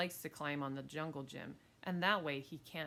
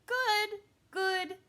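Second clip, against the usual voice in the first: very high, loud single words; little kid repeating loudly; toddler parroting; 9 dB louder than usual. Acted. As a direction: chirp it like a small child.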